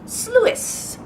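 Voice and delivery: very high squealed sloo-iss; high-pitched playful squeal. Shown as a demonstration. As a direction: squeal the word in two syllables. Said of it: "like, squeal".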